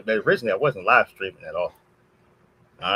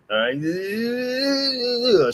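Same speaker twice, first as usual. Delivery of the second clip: drawn-out, higher power-up "all right"; anime power-up; 11 semitones higher than usual. Pretend. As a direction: charge up like a cartoon fighter powering up. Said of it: "getting into a Dragon Ball Z moment".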